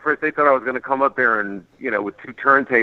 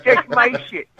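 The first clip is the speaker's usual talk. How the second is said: very high, mock Southern drawl; Southern lady; 13 semitones higher, slower than usual. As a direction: imitate a North Carolina woman, pitched high. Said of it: "this lady was like".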